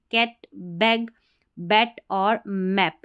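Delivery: कैट, बैग, बैट और मैप with a short open a, drawn out slowly; short a sound. Shown as a demonstration. Teacher models the short a for students, slow and clear. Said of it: "short sound ए का आएगा".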